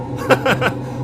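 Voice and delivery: loud high burst of laughter. Real, not acted. Host laughs at caller.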